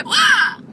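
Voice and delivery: high honking hiccup; dying goose hiccup. Real, not acted. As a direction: honk like a goose.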